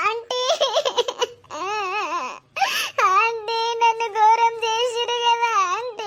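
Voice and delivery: high, slow sobbing plea; crying. Acted. Bride sobbing to her husband's mother, crying. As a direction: weep it out, begging for help. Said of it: "ఏడవకరా".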